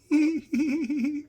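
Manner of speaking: high-pitched imitated giggling; mock giggle, high and repetitive; bad giggle impression. Narrator imitating Doctor Ruth giggling in the car, high mock giggle. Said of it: "do a really bad impression of her".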